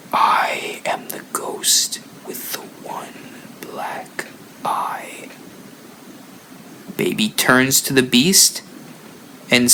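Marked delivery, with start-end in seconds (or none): 0.1-5.3 soft, slow spooky whisper; ghost whisper. Acted. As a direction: breathe it like a ghost in the dark. Menacing ghost voice by cheek.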